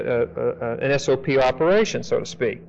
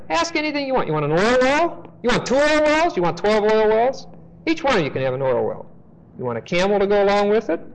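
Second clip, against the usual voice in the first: eager salesman; 6 semitones higher than usual. Acted. Preacher plays Shechem offering gifts.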